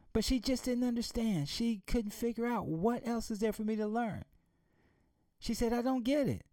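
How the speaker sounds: put-on high, puzzled little girl; confused daughter. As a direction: lift into a bewildered girl's voice.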